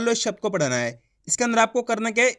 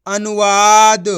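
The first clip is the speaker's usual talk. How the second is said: drawn-out, loud अनुवाद for the class; teacher sounding the word out in full; slow loud blended word; 10 dB louder, much slower than usual. Shown as a demonstration. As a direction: stretch the whole word out clearly. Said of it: "बा में छोटू की मात्रा".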